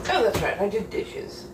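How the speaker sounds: normal talking voice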